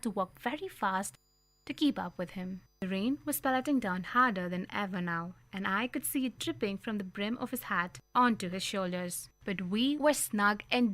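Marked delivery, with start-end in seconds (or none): none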